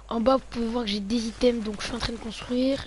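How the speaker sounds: ordinary talk, nothing marked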